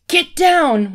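high, loud yelled warning. A narrator's reading. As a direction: yell it as an urgent order. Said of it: "one of them yelled".

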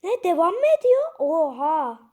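high, loud disbelieving shout; shocked outcry. Real, not acted. Shock the game continues. (yüksek sesli şaşkın bağırış)

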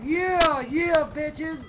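high, loud gamer whoops then a shriek; triumphant yelling. Real, not acted. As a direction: scream with real glee, then dismay. Firing missiles at enemy chopper.

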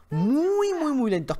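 first "muy" stretched long and high; emphatic repetition. Real, not acted. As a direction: draw out "muy" in a high swoop, then repeat it. Stressing how slow the punches are.

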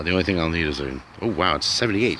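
normal talking voice

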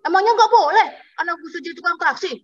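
high, fast, indignant retort; angry taxi driver. Retold or imitated. Taxi driver defends himself to laughing passengers, mock angry. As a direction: play an offended driver, rushing the words. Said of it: "Lalu dia marah. Dia bilang".

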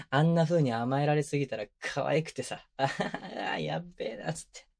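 ordinary delivery

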